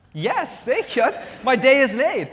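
hammed-up high-pitched gratitude; flattered delight. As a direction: gush as if the compliment made your day. Acts his flattered reply to compliment.

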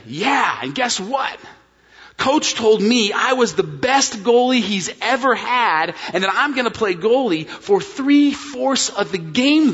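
put-on high, eager boasting kid voice; excited kid. Son bragging to mom after practice, high and eager. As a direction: play the thrilled ten-year-old bragging.

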